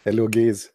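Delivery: "gays" with an "ay" vowel, meant as guys; mispronounced greeting. Retold or imitated. Newcomer repeating his chat room slip "gays", mispronounced. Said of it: "I was saying".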